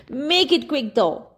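put-on high, impatient old woman voice. A narrator's reading. Old woman hurries the traveller, high and impatient. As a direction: hurry him along.

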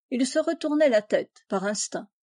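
ordinary delivery

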